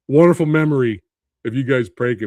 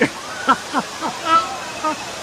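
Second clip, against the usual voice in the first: high-pitched helpless laughter; high laughing fit; 13 semitones higher than usual. Real, not acted. Watching old video of himself.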